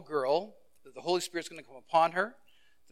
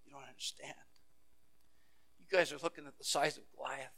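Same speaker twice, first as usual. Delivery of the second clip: much softer, intimate; soft, earnest; 13 dB quieter than usual. Acted. David quietly correcting his brothers about Goliath, softly.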